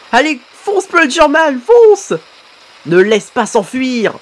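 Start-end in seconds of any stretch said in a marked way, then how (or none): 0.1-2.1 rising to a high yell on fonce; excited cheering. Real, not acted. Fan cheering on Plungerman, excited yell. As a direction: urge him on like a fan.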